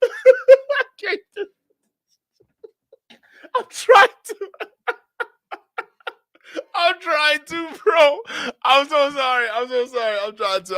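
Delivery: trying to talk through a fit of giggles; helpless laughter. Real, not acted. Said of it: "I can't do this with a straight face".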